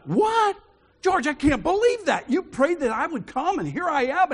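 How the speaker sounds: high, loud, amazed disbelief; state of shock. Retold or imitated. Stunned at George's answered prayer, high amazed voice. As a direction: blurt it out in stunned wonder. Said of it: "you talk about a state of shock".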